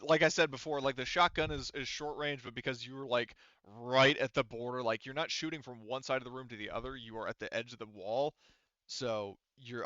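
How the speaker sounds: ordinary talk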